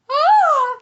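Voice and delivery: high, very loud scream; imitated scream. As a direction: imitate a woman's scream at full volume. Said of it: "my best imitation of her scream".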